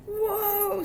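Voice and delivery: very high, long-held whoa; startled cry. A narrator's reading. Rope yanks kitten under the curtain, startled cry. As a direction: let out a high sustained yelp of alarm.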